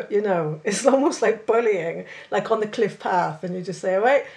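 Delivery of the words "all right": Cornish greeting. Retold or imitated. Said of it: "I really put it on".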